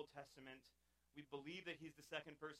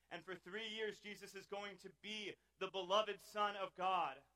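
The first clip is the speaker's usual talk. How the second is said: louder, building preacher's climax; preaching at full force; 5 semitones higher, 11 dB louder than usual. Acted. Preacher building to the cross for the congregation, loud and rising. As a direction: drive it home, swelling.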